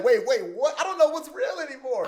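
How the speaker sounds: high, loud mock bewilderment; imitating a stunned listener; baffled onlooker. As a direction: act out total confusion at full volume.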